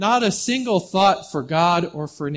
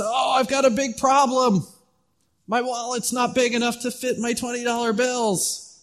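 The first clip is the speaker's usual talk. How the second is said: higher put-on voice of a fretting rich man; mock rich man; 7 semitones higher than usual. Pastor mocking the fictional rich man's small problem. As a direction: mimic his petty worry.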